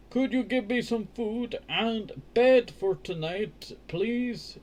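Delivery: high, slow begging plea; lightly voiced pleading Jack; pleading boy. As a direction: beg politely like a hungry boy. Jack begs the woman.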